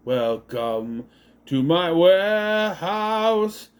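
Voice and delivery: slow, drawn-out mock villain welcome; very slow sinister host voice. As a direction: savour each word like a movie villain. Villain greeting voiced in recap.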